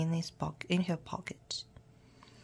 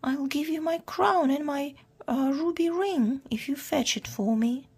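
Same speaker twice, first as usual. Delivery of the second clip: high, louder princess plea; distressed storybook princess, pitched up; tearful pleading; 8 semitones higher, 5 dB louder than usual. A narrator's reading. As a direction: beg through pretend tears. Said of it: "she sobbed".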